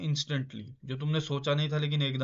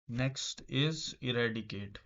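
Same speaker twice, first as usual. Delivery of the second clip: very slow, each word drawn out; slow dictation; much slower than usual. Shown as a demonstration. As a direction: spell it out slowly for the class. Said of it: "teacher reads word slowly". (शब्द को धीरे-धीरे खींचकर पढ़ना)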